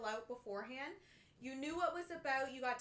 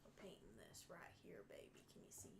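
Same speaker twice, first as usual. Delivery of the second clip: soft whispered narration; whispering to a friend while painting; 8 semitones lower, 21 dB quieter than usual. Real, not acted.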